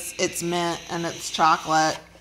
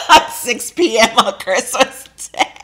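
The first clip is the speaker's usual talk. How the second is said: laughing; 4 semitones higher, 6 dB louder than usual. Real, not acted. Teasing a Scrooge husband.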